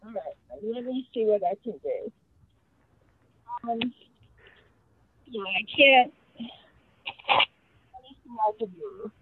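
far from the mic, faint and distant; talking away from the speakerphone; phone held away. Real, not acted. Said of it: "It sounds like you're far away from the phone".

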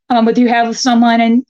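ordinary talk, nothing marked

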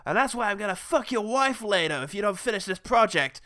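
hammed-up threatening boss tirade; asshole boss. Boss threatening employee over unfinished project, mock obnoxious. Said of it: "having an asshole boss".

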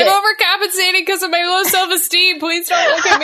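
high, loud mock-pitiful self-pity; mock insecure whine. Friend mocking herself after joke, in high mock-pitiful voice. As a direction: whine it like a wounded ego.

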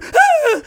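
high loud scared yelp; mock scream. Imitating the guy screaming on the bumpy flight. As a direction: let out a panicked yelp. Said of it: "he, he".

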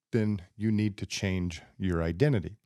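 usual speaking voice

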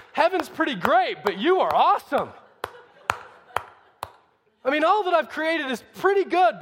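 high, loud gushing amazement; hammed-up awe, heaping on the praise; mock-impressed God. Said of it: "As if he expected God to go".

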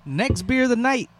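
slow, stretched-out segment intro; playful announcer. Pretend. Host introducing the next beer, drawn-out announcer call. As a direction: draw it out like a big reveal.

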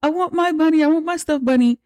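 put-on very high, pleading child's voice; little daughter refusing a new bunny; whining little girl. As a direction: beg like a kid who wants her bunny back. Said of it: "Agnes is like".

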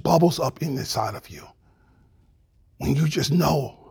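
soft, near-whispered low sermon; closing the sermon in a whisper; hushed preaching. Real, not acted. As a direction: drop to a tender hush. Preacher drops to hush.

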